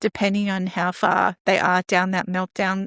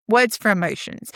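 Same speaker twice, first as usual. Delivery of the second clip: ordinary delivery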